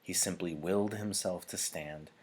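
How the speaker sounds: ordinary delivery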